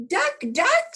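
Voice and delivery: very high, loud call; calling Duck across the pond. Acted. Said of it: "said Hen".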